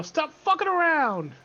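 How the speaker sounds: loud, high-pitched scolding yell; mock scream. Merchant screaming at a party member as bardic inspiration, scolding yell. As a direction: yell it as an in-game order to shape up. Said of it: "scream at Ariella".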